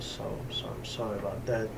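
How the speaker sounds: usual speaking voice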